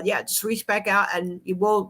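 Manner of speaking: normal talking voice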